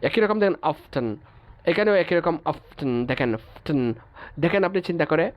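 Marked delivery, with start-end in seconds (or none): 0.7-3.8 অফটেন with t sounded between f and n; T pronounced. Shown as a demonstration. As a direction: force the silent t into অফটেন. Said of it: "উচ্চারণ করতে কষ্ট হয়".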